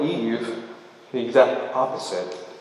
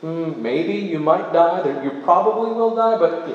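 mock unsure; 6 semitones higher than usual. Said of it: "connotes this sort of".

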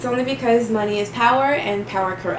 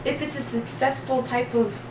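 normal talking voice

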